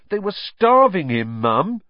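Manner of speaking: high, loud appeal to Mum; indignant protest. A narrator's reading. Son defending the rescue to his mother, indignant protest. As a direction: plead the case, aggrieved.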